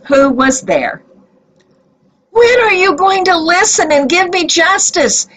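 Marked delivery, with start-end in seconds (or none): 2.3-5.3 demanding. A narrator's reading. Widow confronting the judge at the market, demanding. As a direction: press him hard for an answer. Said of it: "she demanded".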